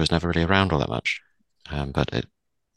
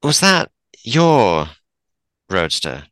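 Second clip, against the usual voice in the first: pointed question; 4 semitones higher, 7 dB louder, much slower than usual. Acted. Vera confronts about reckless driving.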